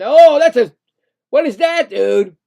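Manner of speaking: loud, high sudden shock; real disbelief; shocked outburst. Real, not acted. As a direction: react to a dirty hit. Reacting to a hit live.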